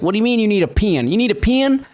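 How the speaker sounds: puzzled Southerner, "pen" said like "pin"; Southern accent. Retold or imitated. Imitating confused Southerners hearing "pen", Southern accent. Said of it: "sound exactly the same to people in the South".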